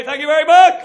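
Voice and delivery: high, loud sign-off; comic closing his set, loud; loud thank-you. Real, not acted. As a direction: shout the thanks over the applause. Closing to audience.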